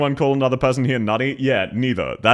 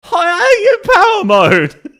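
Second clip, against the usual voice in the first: very high, loud, drawn-out fake Australian; exaggerated mock accent; 8 dB louder, much slower than usual. Streamer mocks the played clip, shouted fake Australian accent. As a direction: wail a parody of the bad Australian accent. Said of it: "exaggerate the bits that are wrong".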